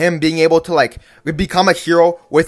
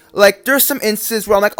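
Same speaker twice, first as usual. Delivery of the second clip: ordinary talk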